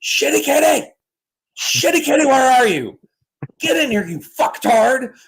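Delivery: high, loud yelling for a cat from the porch; hollering a cat home across the yard; neighbor yelling for cat. Retold or imitated. Retelling the neighbor calling his cat, hollering high. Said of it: "And I just hear".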